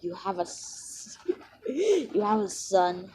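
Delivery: slow, breaking into giggles; laughing mid-line. Acted. Character learns of a son, teller slowly laughing through it. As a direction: crack up partway through the acted question. Storyteller cracks up acting out dialogue.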